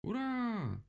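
high, long hurrah; joyful cheering. A narrator's reading. Animals celebrate the captured owl, cheering joyfully. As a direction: squeal a drawn-out cheer. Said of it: "обрадовались звери".

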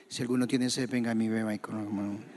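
low, quick mumbled reading; apagado, sin alzar la voz. Shown as a demonstration. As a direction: rattle it off low and soft, as if nobody should hear. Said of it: "Jesús no iba a decir eso así". (murmullo bajo y sin fuerza)

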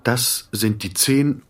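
plain reading voice

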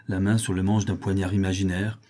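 usual speaking voice

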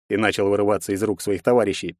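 usual speaking voice

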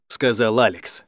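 ordinary delivery

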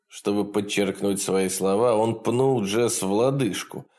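plain reading voice

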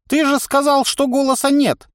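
cackling toothless ranting. Acted. Old man ranting.

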